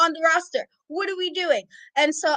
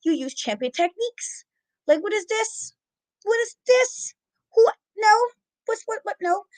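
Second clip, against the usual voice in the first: exasperated scolding; 5 semitones higher than usual. Retold or imitated. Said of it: "I'm like".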